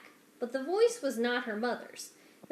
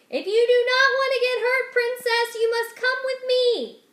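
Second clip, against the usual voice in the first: high, loud stilted shout; a loud, high shout, reciting a learned part; 13 semitones higher, 11 dB louder than usual. Acted. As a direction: bellow the rehearsed line at her. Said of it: "shouted her part".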